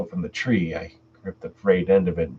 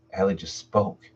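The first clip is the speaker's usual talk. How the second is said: plain reading voice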